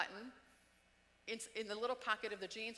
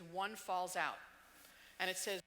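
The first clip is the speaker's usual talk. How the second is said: ordinary talk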